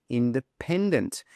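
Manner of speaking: "independent" with a clear beat on PEN; stress on PEN. Shown as a demonstration. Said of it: "The stress is on the pen".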